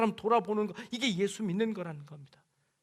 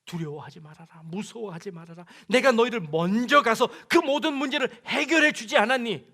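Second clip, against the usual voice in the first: God reassuring; 3 semitones higher, 8 dB louder than usual. Acted. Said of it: "이렇게 말씀하십니다".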